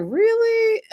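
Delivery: high-pitched, disbelieving "really?"; retold incredulous reaction. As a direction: question it high, in mock disbelief. Retold own incredulous reaction to the call.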